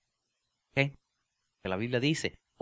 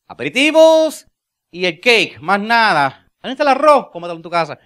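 high, loud joking declaration; playful shout; mock wedding rant; 7 semitones higher, 12 dB louder than usual. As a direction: proclaim it like a silly groom.

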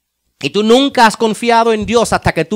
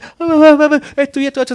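mock crying complaint; 9 semitones higher, faster than usual. Pastor mimics a person venting problems, half-crying. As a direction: imitate someone near tears over small things. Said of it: "están llorando casi".

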